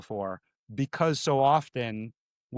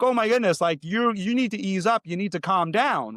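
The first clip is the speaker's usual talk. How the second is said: mock scolding; exasperated; 6 semitones higher than usual. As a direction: tell them to settle down. Reacting to over-joyful person.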